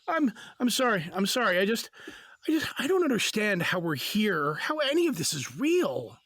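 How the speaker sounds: plain reading voice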